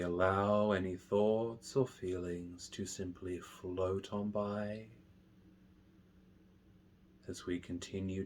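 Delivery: hushed, unhurried guidance, letting each phrase settle; guided meditation. Real, not acted. Meditation teacher guiding listeners through mindful breathing, slow and soft.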